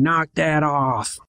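put-on slow, drawn-out old man's call; a hint of a stern old neighbour, stretched slow; lightly voiced old man telling kids off; old man shouting. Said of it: "an old man's voice shouting".

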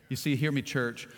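usual speaking voice

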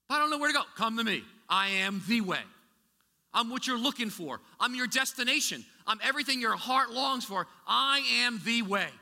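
high, loud preaching call; full-force preaching. Acted. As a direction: preach it out to the congregation.

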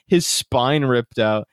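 ordinary talk

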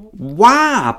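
high-pitched demanding question. A narrator's reading. Pilate challenging the crowd, high demanding tone. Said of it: "Pilate demanded".

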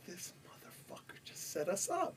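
voiceless, hissed aside; shocked whisper. Retold or imitated. Rapper whispering in shock on the radio show. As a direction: whisper it to yourself in alarm. Realizing the DJ's ambush.

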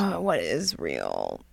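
low, slow, spooky wonder; mock existential dread. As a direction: ham up the cosmic horror.

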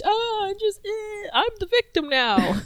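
very high, put-on voice of a self-pitying victim; mock victim whining. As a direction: squeal it in mock self-pity. Mocking self-styled victims of free speech.

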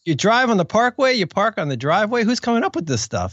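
high, punchy stand-up voice; hacky comedian. Pretend. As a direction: play a corny stand-up.

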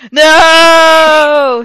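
long, high, very loud "no"; screaming. A narrator's reading. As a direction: scream it out melodramatically. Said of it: "he screamed".

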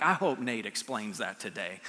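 mock thought, low and soft, teasing; hint of a sarcastic aside; mock bored congregation. Pastor teasing his congregation, dropping low and soft. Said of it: "We're like".

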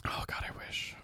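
whispered sigh. Real, not acted. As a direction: whisper it wistfully. Whispered wish.

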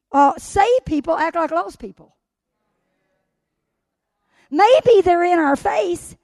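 full-force preaching. Acted. Pastor preaching to the church with fire.

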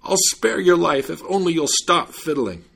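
high, loud storybook plea; desperate cry. A narrator's reading. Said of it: "was out of breath and cried out".

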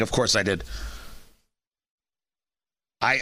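ordinary delivery

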